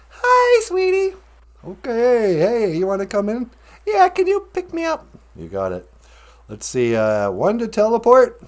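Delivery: ordinary talk, nothing marked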